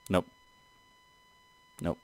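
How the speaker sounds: ordinary talk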